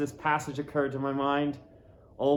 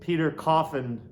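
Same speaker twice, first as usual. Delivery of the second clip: ordinary delivery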